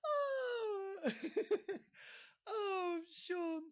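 high, breathless laughing speech; crying with laughter. Real, not acted. Host weeping with laughter at the attempt. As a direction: wheeze through tears of laughter.

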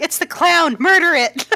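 high, loud comic outcry; mock alarmed shout. Player jokes about the changed voice, mock shout. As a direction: raise a hammed-up alarm, breaking into laughter.

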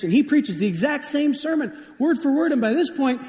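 high, incredulous storyteller's punchline build; comic escalation. Shown as a demonstration. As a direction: climb high and stay there, milking the repetition. Repeat telling, escalated for comedy.

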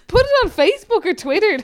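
loud, high, laughing. Retold or imitated. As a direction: laugh through it. Laughing mock plea.

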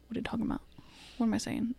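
hushed, searching for the word; whisper. Real, not acted. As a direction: whisper to herself, confused. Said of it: "whispers to herself, lost word".

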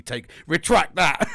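loud, drawn-out angry demand; slow it down and say it loud in anger. Real, not acted. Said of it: "I was really angry there".

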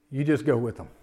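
tender release. Retold or imitated. Son tearfully telling his dying mother to go. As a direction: quietly give her leave to go with the angels.

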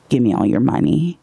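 imitating a cold robber, low and calm; a low, cool and collected demand; steely. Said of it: "in a steely voice".

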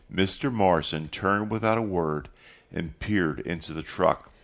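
ordinary delivery

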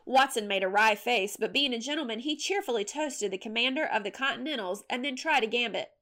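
plain reading voice

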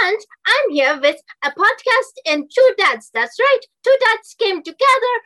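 put-on high, loud German-accented promo; big, swooping German host voice. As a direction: announce it in a hammed-up German accent. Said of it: "in German, but".

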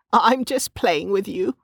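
put-on high, slow, playful old woman; laughing old woman. A narrator's reading. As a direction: reassure her teasingly, pitched high.

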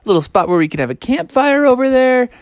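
high, delighted youngster voice; excited Froggy. Acted. Froggy admires the clearing for camp, excited. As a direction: gush with big excitement. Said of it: "and Froggy was like".

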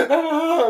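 high, drawn-out mock moan; put-on climax cry; mimicked orgasm cry. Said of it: "finish the orgasm".

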